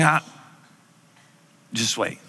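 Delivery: a patient, quiet "not yet"; calm Jesus. Retold or imitated. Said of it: "He's like".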